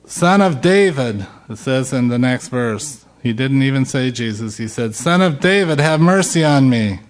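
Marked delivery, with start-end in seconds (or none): 0.0-1.3 raised pleading cry, higher; the beggar refusing to be silenced, crying out louder; yelled louder. Retold or imitated. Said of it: "he only yelled louder". 5.0-7.0 raised pleading cry, higher; the beggar refusing to be silenced, crying out louder; yelled louder. Retold or imitated. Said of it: "he only yelled louder".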